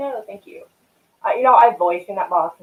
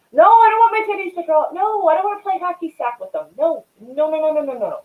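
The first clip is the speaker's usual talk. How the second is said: mock protest; 10 semitones higher, 4 dB louder than usual. Mock protest at chat's joke.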